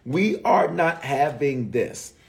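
"We are not having this" slow, every word leaned on; slow, stressed every word. Shown as a demonstration. Pastor making the congregation hear it, slow and firm. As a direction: spell it out word by word, firmly. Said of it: "You gotta hear me".

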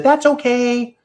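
a hint of a polite lady; lady's voice.

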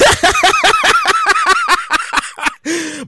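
long, high staccato laugh; real high-pitched cackling laughter; high cackle. Real, not acted. Laughing at the pettiness.

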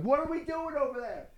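very high, softened mock yell; strained falsetto exasperation, held back; retold yelling. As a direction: squeal it like a far-off shout. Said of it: "I'm yelling at him".

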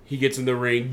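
normal talking voice

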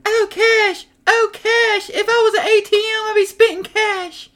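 hammy orgasmic falsetto; imitating his mother in bed, high; mock moaning woman. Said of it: "She was like".

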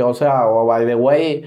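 normal talking voice

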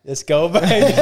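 loud cheer. Real, not acted. Quiz player yelling in triumph after scoring again. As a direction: shout it out in triumph. Celebrating another correct answer.

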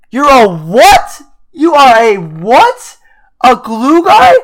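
high-pitched, drawn-out incredulous outburst; loud disbelief. Real, not acted. Reacting in disbelief to player's quote.

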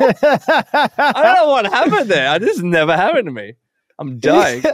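strained high voice, struggling to talk; choked up by a throat tickle, pushing words out; helpless squeak of a failing throat; throat giving out. Real, not acted. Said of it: "My throat just, just died out of nowhere".

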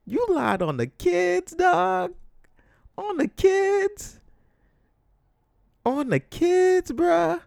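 very high, drawn-out indignation; high, stretched-out appalled complaint; outraged disbelief. Acted. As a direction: wail it slowly in loud disbelief. Ranting in disbelief at the lie.

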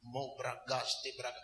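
low, soft mock command; hushed deep imitation, sliding into tongues; Pastor Chris. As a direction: quietly intone it like a composed preacher. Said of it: "he begins to go like that".

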